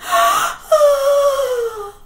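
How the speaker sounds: loud frightened gasp. Acted. Seeing ghost.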